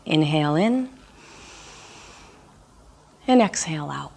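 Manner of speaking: calm meditation voice with long pauses; guided meditation. Real, not acted. Instructor guiding listeners through a body scan, calm meditation voice. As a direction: lead a body scan gently, leaving room to breathe.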